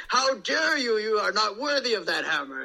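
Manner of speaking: hammed-up heroic outrage; offended Thor in character. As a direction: play an indignant Thor defending his hammer. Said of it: "I'd always be, like, you know, in character".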